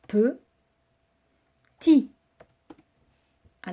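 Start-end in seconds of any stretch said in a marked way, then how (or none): 0.1-2.1 "Petit" split into pe-tit, loud and drawn out; syllable by syllable. Shown as a demonstration. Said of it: "syllabe par syllabe". ("Petit" découpé syllabe par syllabe, fort)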